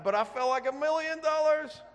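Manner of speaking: high and loud with glee; elated. Real, not acted. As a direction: beam with pride as you say it. Recalling triumph, beaming.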